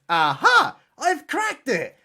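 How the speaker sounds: hammed-up triumphant discovery; mock eureka. Frenchman's eureka moment voiced.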